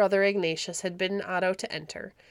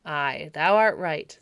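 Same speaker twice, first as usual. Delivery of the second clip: slow booming baron; 2 semitones lower, 7 dB louder, slower than usual. A narrator's reading. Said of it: "said Baron Conrad".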